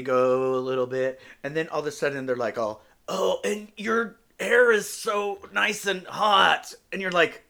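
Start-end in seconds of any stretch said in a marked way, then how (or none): 3.1-6.7 higher, slow, drawn-out fake sultriness; loud, hammed-up cooing; mock inept dirty talker. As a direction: imitate awful dirty talk, stretching every word. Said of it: "they don't know how to do dirty talk".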